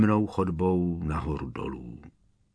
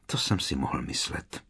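low quiet mutter, softer; 6 semitones lower, 6 dB quieter than usual. Acted. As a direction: mutter it to himself. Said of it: "zamumlal Brumbál".

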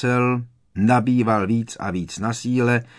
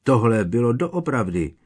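ordinary delivery

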